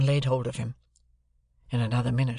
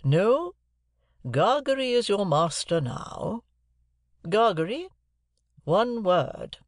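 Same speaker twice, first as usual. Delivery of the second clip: imperious Miss Havisham; 7 semitones higher, slower than usual. A narrator's reading. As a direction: call it out as a commanding lady. Miss Havisham gives orders.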